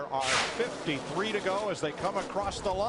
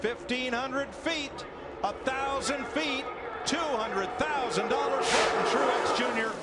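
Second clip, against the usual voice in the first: excited race call; 4 semitones higher than usual. Real, not acted. Announcer counting down to the two hundred thousand dollar bonus, building excitement. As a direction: build the excitement as the leader nears the line. Calling the run to halfway bonus.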